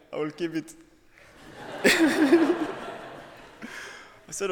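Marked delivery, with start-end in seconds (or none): 1.5-3.2 high, sudden laugh; high burst of laughter. Real, not acted. To the audience, keeping his reaction to the admission secret, high laugh.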